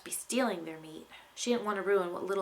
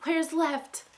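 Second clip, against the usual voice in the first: high, loud confused panic; panicked; 8 semitones higher, 5 dB louder than usual. Acted. Unicorn galloping blind, panicked and loud. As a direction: yelp it, lost and scared. Unicorn lost mid-escape.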